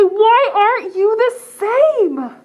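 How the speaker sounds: mock-scold the odd pigeon out loud; yell. Pigeon flock yelling at the different pigeon, hammed-up loud. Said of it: "they all yell".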